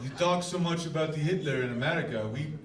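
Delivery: put-on low, quiet, deadpan Russian accent; a cold, flat Russian threat. Putin voiced, Russian accent.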